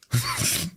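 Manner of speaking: a sudden high, cracking giggle; high squeaky laugh. Real, not acted. Streamer laughing at his own yell with a squeak.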